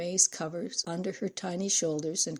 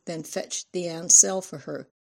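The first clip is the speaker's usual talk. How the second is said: ordinary delivery, nothing marked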